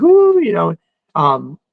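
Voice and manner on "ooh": high, louder teasing ooh from the crowd. Retold or imitated. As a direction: whoop it up like friends teasing the groom. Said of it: "So they were like".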